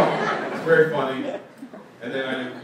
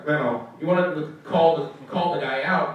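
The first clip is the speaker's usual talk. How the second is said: usual speaking voice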